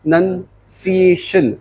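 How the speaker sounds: प्रोनंसिएशन slowly broken up as prun-nun-see-ay-shun; syllable-by-syllable pronunciation. Shown as a demonstration. Teacher models it for class, प्रोनंसिएशन in slow separate syllables. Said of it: "इसे प्रोनंसिएशन बोलते हैं".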